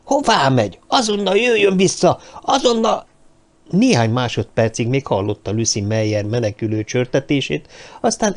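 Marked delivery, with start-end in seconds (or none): 0.0-3.1 high urgent shout; shouting after someone. Acted. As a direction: call her back sharply. Shouting after fleeing woman.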